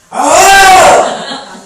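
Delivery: loud high roar breaking into a laugh; a laughing playful lion roar. Pretend. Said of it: "아, 사자 어떻게 울어?".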